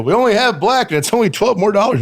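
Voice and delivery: sing-song the upsell; bartender upsell. Retold or imitated. Bartender tells the college kid about the pricier scotch, mock sing-song. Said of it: "They go".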